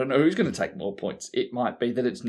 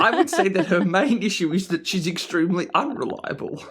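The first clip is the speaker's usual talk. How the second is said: teasing sarcasm; 8 semitones higher, 3 dB louder than usual. Real, not acted. Teasing co-host about player.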